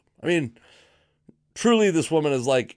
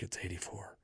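very hushed, lowered aside; whispering; 5 semitones lower, 20 dB quieter than usual. Retold or imitated. Diner feeding the answer to a friend, whispering. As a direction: murmur it secretly to a companion. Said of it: "I'm whispering to Gabe".

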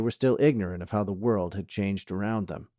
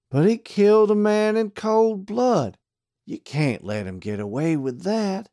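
pleading deputy; 11 semitones higher, 5 dB louder than usual. A narrator's reading. Pete the deputy protests.

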